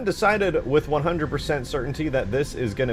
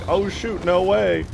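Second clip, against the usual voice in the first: higher, louder burst of sudden discovery; excited realization; 8 semitones higher, 5 dB louder, slower than usual. Retold or imitated. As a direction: replay your own eureka moment out loud. Acting out his shower-thought realization.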